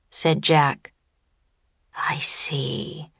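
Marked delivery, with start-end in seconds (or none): 2.0-3.1 hushed wizard whisper. A narrator's reading. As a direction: breathe it out as a whisper. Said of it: "whispered the wizard".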